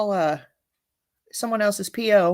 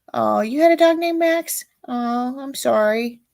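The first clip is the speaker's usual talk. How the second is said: mock sympathy; 6 semitones higher than usual. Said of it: "I was reading some of the comments".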